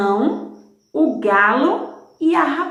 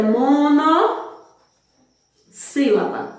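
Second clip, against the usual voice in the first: "monossílaba" with a long pause after mono; split term; 3 semitones higher, much slower than usual. Shown as a demonstration. Teacher naming the class for pupils, broken in two parts. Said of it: "que não separa, só tem uma".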